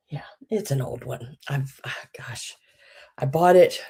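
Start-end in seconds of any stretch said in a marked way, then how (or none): 0.1-2.6 soft whisper; thinking aloud under the breath; quiet muttered musing; whispered aside. Real, not acted. Muttering to self.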